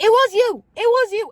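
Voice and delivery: imitated panic, high and loud; mother's frantic, high-pitched accusation; scared mom. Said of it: "I hear in her voice that she's so scared".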